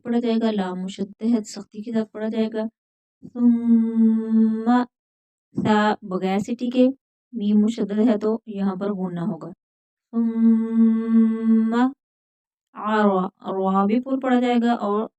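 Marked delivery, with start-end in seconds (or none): 3.2-4.8 सुम्मा slow, the doubled m held in a nasal hum; सुम्मा drawn out melodically; recited model word. Shown as a demonstration. 10.1-11.9 सुम्मा slow, the doubled m held in a nasal hum; सुम्मा drawn out melodically; recited model word. Shown as a demonstration. Models again.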